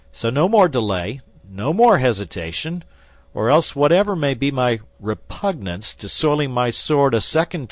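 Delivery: loud, rising menacing oath; fierce threat. Acted. Guardsman threatens the wounded bandit, loud fierce oath. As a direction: swear it like you mean to kill.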